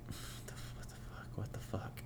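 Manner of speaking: whispered disbelief to himself; stunned whisper. Retold or imitated. Date stares at him mid-movie, stunned whisper. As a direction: whisper it, baffled, under your breath.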